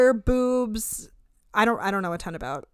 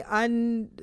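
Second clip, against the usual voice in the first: ordinary talk, nothing marked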